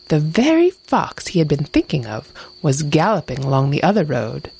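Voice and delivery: usual speaking voice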